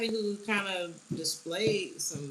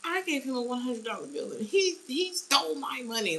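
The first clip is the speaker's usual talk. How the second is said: higher, insistent complaining voice; whiny Karen; 7 semitones higher than usual. Retold or imitated. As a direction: whine it like you were cheated. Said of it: "really tried to get this man jammed up".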